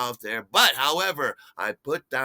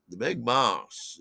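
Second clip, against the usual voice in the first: usual speaking voice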